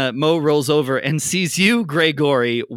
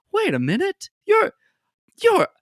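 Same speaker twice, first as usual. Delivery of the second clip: Mo recognizing him; 3 dB louder than usual. Acted. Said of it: "looks a little closer and goes".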